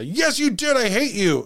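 high-pitched mock tantrum; Patrick-style outburst. Dad screaming at his children over windows, Patrick-style outburst. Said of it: "he would Patrick out, as we call it".

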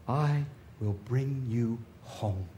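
very slow, low and soft; hushed, unhurried promise of welcome; tender reassurance. Acted. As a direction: voice God's promise gently, drawing out each word. God's tender promise closes the sermon.

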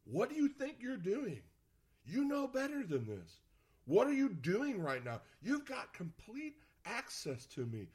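high, emphatic reprimand, slowing on the final question; scolding God. Acted. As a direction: scold them like an exasperated parent. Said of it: "God may speak to you that way".

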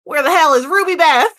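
hammed-up country twang; country accent. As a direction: call out like a riled country farmer. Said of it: "put on your best country accent".

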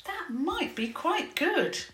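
put-on high, slow sighing girl. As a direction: let the words out on a sigh. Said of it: "she sighed".

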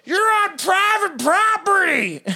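high, loud angry yell; screaming. Retold or imitated. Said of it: "He began to scream at the company".

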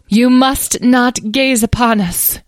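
high, loud, slow command; imperious fairy. A narrator's reading. Muddy fairy forbids visitors to look, imperiously. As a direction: issue it like a tiny haughty monarch. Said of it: "in an imperious tone".

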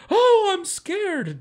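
very high, slow, hammed-up fright; mock scared. Man pretending fear at the vandals, squeaky high and slow. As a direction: squeal it like a terrified cartoon. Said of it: "I should have just been like".

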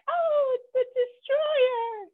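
high, slow, whimpering cry of a weak man; mock fearful wail. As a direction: cower and wail in mock terror. Weak man cowering in bush.

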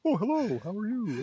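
lightly put-on Cardiff character voice. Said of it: "I don't even remember what my Cardiff voice was anymore".

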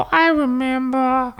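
high, slow, dreamy cartoon grape; Member Berries voice. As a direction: sigh it out like a content grape. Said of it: "he goes".

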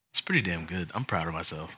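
ordinary talk

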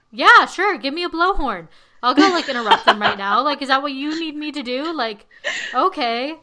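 overdone eager yes; eager mock agreement. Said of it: "I'd be like".

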